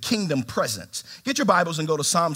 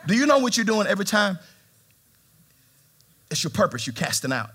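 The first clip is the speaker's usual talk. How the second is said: usual speaking voice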